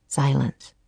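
plain reading voice

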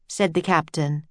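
usual speaking voice

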